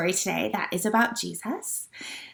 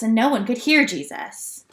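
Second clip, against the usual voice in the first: plain reading voice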